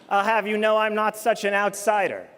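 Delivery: usual speaking voice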